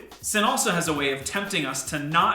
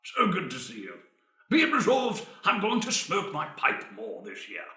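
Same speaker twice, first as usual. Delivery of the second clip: lightly voiced pompous old Englishman; old British man. Host making a formal resolution, mock old British man. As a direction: play a stuffy old British gent. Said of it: "do the old British man".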